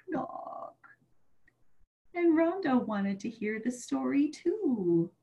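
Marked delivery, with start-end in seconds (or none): 0.1-0.8 hushed final knock; whispered knock. Acted. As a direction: breathe it out as a whisper.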